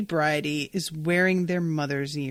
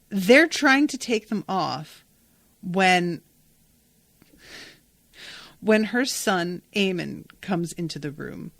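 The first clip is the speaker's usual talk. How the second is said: ordinary delivery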